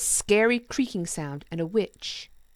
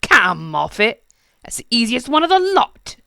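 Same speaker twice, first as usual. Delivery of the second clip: scoffing goblin king; 5 semitones higher, 9 dB louder than usual. Acted.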